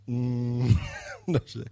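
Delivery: usual speaking voice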